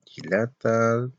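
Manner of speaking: "Hilata" said slowly and softly; slow soft Aymara word. Shown as a demonstration. Teacher repeats the word quietly for the class. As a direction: ease out "Hilata" gently and slowly. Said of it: "¿Y el hermano?".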